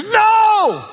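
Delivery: sharp high shouted No!; a high, loud, shocked No! from Peter; Peter's no. Retold or imitated. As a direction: blurt a high, loud refusal. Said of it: "And Peter says".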